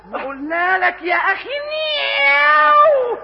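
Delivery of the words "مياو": long high meow, fed up; exasperated cat meow. Pretend. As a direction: meow as if stating the obvious. Thief insisting he's a cat.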